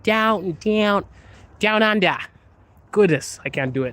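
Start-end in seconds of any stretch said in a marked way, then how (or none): 0.0-2.2 higher, twangy Aussie vowels; Australian accent. Shown as a demonstration. Streamer drilling the sound, exaggerated Australian accent. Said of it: "the accent is so twangy".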